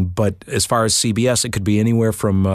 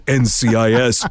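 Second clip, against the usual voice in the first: promo announcer. Retold or imitated. Promo read under coat.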